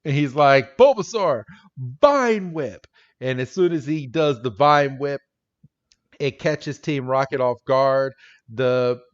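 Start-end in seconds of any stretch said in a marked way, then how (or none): 0.8-2.9 raised, louder call to attack; imitated battle command. Ash orders Bulbasaur's attack, mock battle cry. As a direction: shout it like a cartoon trainer. Corrected trainer command called out.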